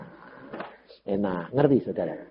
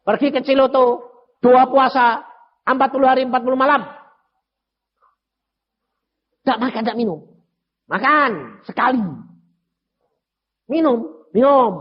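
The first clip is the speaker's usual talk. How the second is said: raised, louder voice of God giving orders; voicing God's command; 10 semitones higher, 4 dB louder than usual. Preacher voicing God ordering him to fast, loud. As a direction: boom it out like a divine command. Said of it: "Tuhan bilang".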